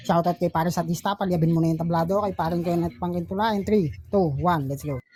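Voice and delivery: punchy hyped-up announcer call; battle host announcer. Acted. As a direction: hype the crowd like a ring announcer.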